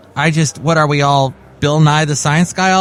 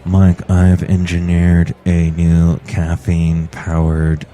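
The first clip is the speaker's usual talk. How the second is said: much lower, slow engineer voice; John Deere voice; 9 semitones lower, slower than usual. Acted. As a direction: announce the invention low and slow. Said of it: "John Deere, the engineer".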